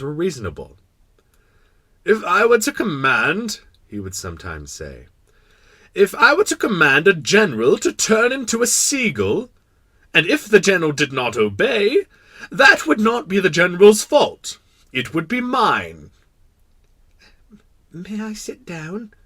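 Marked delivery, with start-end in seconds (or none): 2.0-3.6 loud, high, slow royal voice; pronouncing king. Acted. King stating his reasonable rule, pompous. King's habitual saying. 5.9-16.1 loud, high, declaiming monarch; pompous kindly king. Acted. King explaining his reasonable commands, pompous. 17.9-19.1 high, soft, slow request; timid little prince. Acted. Little prince asking the king to sit, timid. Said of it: "the little prince timidly inquired".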